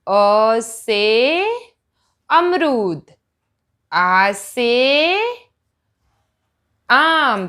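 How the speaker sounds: put-on syllables stretched very slowly for a child; drawn-out classroom recitation; very slow teaching demo. Shown as a demonstration. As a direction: trace the letter as you say it. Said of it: "जैसे".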